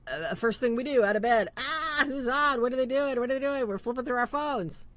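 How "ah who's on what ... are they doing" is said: mock the rush to check the phone, pitched high; high-pitched, nosy mock excitement; eager mimicry. Acting out morning phone checking.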